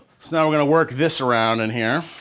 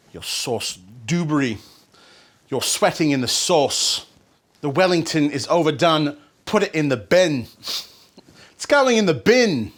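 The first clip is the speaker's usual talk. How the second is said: hammed-up British chef accent drifting toward New Zealand; scolding chef impression in a put-on New Zealand-sounding accent; Gordon Ramsay. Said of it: "I guess I do sound like I'm from New Zealand".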